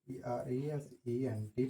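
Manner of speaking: slow, letter by letter spelling. Shown as a demonstration. Teacher spells the word for students, slow letter by letter. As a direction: spell each letter slowly for students copying it down. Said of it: "spells the word aloud".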